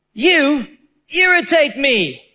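retelling a clash in front of everybody, mildly irritated; irritated retort. Retold or imitated. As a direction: say it like you've lost patience. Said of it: "So I lost my cool".

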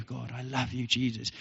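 soft, murmured words to Jesus; quiet, intimate prayer; hushed devotion. Real, not acted. Tells God of love, aside.

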